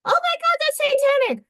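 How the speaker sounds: very high, mocking scandalized voice; mocking people who panic at the star, shrill and high; mock horrified gasp. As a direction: parody a pearl-clutcher, squeaky high. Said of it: "I don't care if the star's facing upside down".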